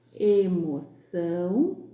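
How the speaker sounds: Emoção said slowly, nasal ão stretched; slow teacher demo of Emoção. Shown as a demonstration. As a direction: draw out the ão in Emoção. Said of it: "Com som ã e ão".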